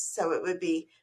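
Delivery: ordinary talk, nothing marked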